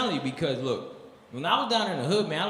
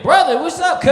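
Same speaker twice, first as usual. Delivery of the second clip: shouted, high Crip voice; loud gangster greeting; 14 dB louder than usual. Retold or imitated. Crip shouting a greeting at the out-of-town comic.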